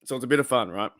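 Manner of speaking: ordinary talk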